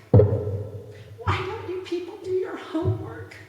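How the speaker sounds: slow, high, drawn-out scolding of Entertainment people; lecturing them on their homework, slow and exasperated. Real, not acted. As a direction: spell it out slowly like a fed-up teacher.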